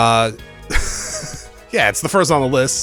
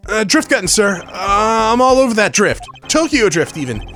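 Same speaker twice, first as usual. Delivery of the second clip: higher, jittery rookie voice; nervous young lawyer; 7 semitones higher than usual. Acted. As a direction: overeager and panicky, trying to sound fine. Voices Apollo.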